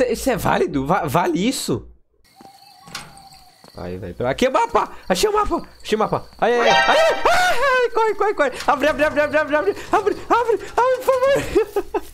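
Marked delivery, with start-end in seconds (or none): 4.3-6.3 high excited shout; triumphant yelling. Real, not acted. Finding map while chased. 6.4-12.1 very high frantic screaming; desperate panicked pleading; terrified. Real, not acted. Fleeing monster, door won't open.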